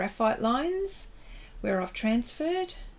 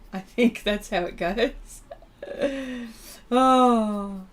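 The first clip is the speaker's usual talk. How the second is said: usual speaking voice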